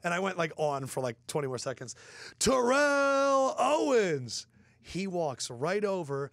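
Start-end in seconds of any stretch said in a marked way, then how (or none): 2.4-4.5 high, drawn-out announcer call; big, high stadium introduction; arena announcer. Retold or imitated. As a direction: stretch the name out like an MC. Acting out announcing the name.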